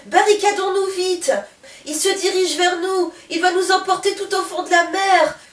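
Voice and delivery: high, loud frightened shouting; captain panicking in front of the monster; panicked cry. Acted. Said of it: "s'écrie Polo".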